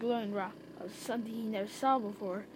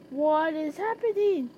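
higher, louder alarmed question; raised-voice yell in story reading; mild yell; 6 semitones higher, 6 dB louder than usual. A narrator's reading. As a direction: yell it lightly, as a storyteller would. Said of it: "yelled Cloud Puff".

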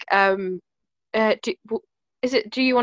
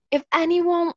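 put-on higher-pitched American accent; light mock American accent; 7 semitones higher than usual. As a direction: slip into an American accent for the quote. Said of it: "saying an American accent".

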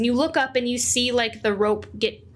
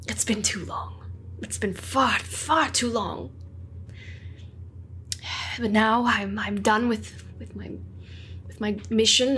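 Marcus, tender reunion; 5 dB quieter than usual. Acted. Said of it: "the other man kind of looks at him and goes".

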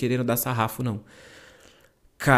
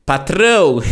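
higher, much louder hail; loud call-out; 5 semitones higher, 11 dB louder, slower than usual. Retold or imitated. Employee calling up to the boss's office, loud playful shout. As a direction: playfully belt it out to the boss. Calling out to his boss upstairs.

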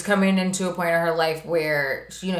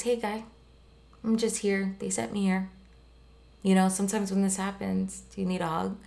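softer, gentle, barely pushing the voice; soft sweater voice; 8 dB quieter than usual. Acted. Grieving sweater comforts the son, speaking softly. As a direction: coax him softly like a kind sweater. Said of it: "He talks really softly like this".

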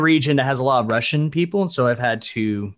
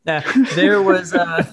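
laughing; 6 semitones higher, 3 dB louder, slower than usual. Real, not acted. Embarrassed by dildo question.

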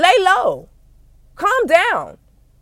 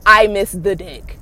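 mocking mope; 6 semitones lower, slower than usual. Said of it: "it's giving me very much so".

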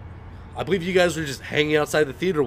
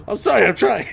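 high, loud mock-desperate apology; flustered apology; 8 semitones higher, 7 dB louder than usual. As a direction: plead helplessly, hamming up the fluster. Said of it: "always be like that and everything".

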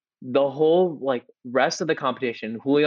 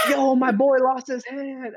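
much higher, slow hype-man voice; hyped teammate filming; 13 semitones higher, slower than usual. Retold or imitated. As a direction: play the bro filming on TikTok, stretched out. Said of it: "and he's like".

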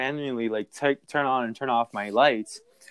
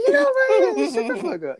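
sudden high shriek, half laughing; playful squeal of protest; mock outburst. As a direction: yelp it out in fake outrage. Mock outburst at teasing friend.

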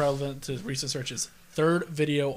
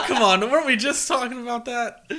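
laughing shock; 5 semitones higher, 11 dB louder, slower than usual. Real, not acted. Shocked at search results.

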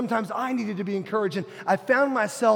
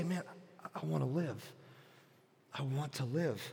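very soft, almost breathed prayer; quiet under the breath; 6 semitones lower, 14 dB quieter than usual. Retold or imitated. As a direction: murmur it to yourself, barely audible. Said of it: "say this kind of quietly under my breath".

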